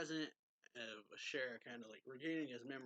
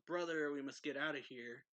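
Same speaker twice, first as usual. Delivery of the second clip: mild mock yell, raised and urgent; retold yell; 3 semitones higher, 6 dB louder than usual. Sister urging her brother to flee, mildly yelled retelling. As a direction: imitate a yell to get away, a little louder. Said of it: "And she yells".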